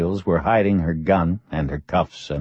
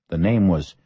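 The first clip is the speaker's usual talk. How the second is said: ordinary delivery, nothing marked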